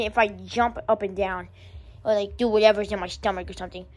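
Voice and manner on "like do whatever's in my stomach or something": usual speaking voice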